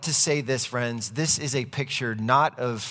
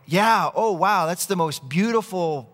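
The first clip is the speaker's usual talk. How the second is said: higher, louder gushing as a pretending onlooker; feigned admiration; 5 semitones higher, 4 dB louder than usual. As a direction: fake being wowed by the robe. Onlooker feigns admiration to avoid seeming foolish.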